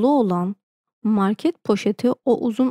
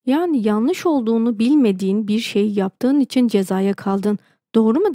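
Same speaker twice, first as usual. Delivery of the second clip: ordinary delivery, nothing marked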